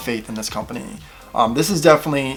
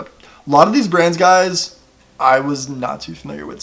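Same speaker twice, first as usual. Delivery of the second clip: normal talking voice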